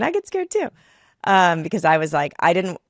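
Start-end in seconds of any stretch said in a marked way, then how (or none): 0.0-0.7 mock scared. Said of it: "rather than going".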